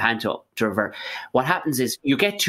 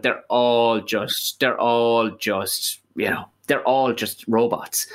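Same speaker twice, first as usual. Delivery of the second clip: slow dramatic; 2 semitones lower, much slower than usual. Acted.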